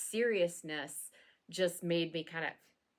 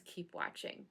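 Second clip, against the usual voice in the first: whispered; 2 semitones lower, 12 dB quieter than usual. Acted. Teasing sign-off.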